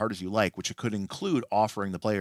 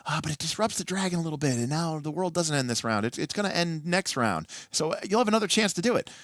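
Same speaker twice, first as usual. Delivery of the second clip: high, hesitant, placating voice, pitched well up; hammed-up wishy-washy dungeon master, voice lifted high; 9 semitones higher than usual. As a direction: mimic a GM who can't bear to let the dragon win. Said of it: "it'd be like".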